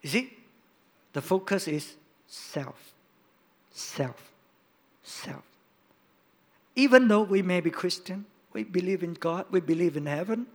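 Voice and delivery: ordinary talk, nothing marked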